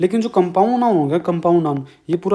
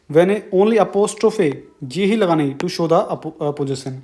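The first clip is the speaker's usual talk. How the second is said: slow dictation; much slower than usual. Shown as a demonstration. Teacher dictating rule for notes.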